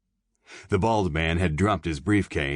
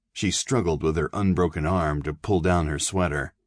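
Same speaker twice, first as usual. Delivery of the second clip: plain reading voice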